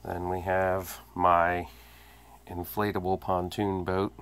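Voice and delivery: ordinary talk, nothing marked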